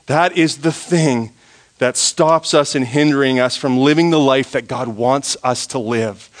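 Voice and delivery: normal talking voice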